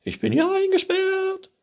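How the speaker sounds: very high, loud wail; princess calling out. A narrator's reading. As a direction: shriek the complaint from a tower. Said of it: "rief die Prinzessin". (hohes, lautes Prinzessinnengeschrei)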